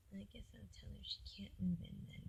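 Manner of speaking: whispered grudging concession; hushed grudging boyfriend; mock sulky mumble. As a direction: sigh it out like a sulking boyfriend. Boyfriend quoted sulking.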